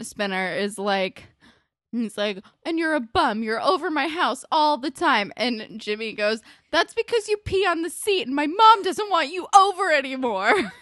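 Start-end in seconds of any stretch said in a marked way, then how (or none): 2.6-5.3 raised, loud imitation of a squabble; mock-yell the accusation; retold yelled insult. Spinner insults Jimmy as they argue, mock yelling. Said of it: "they keep yelling at each other". 6.7-10.7 high, loud mock yell throughout; retold yelled comeback. Jimmy yells back at Spinner from the argument, mock yelling. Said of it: "they keep yelling at each other".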